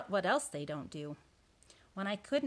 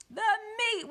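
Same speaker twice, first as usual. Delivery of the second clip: very high, drawn-out roar; crew roaring; 6 dB louder than usual. A narrator's reading. Crew echoes captain at dinner, high roar. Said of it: "we all roared".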